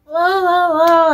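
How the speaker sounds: hammed-up crying whoa of a frightened woman; imitating crying. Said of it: "she's crying".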